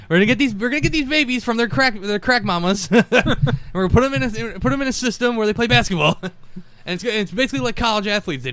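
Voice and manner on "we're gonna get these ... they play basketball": high, fast cartoon kid pitch; Cartman impression. Cartman pitching his basketball league, high and fast. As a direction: rattle it off like a scheming cartoon kid. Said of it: "It's like".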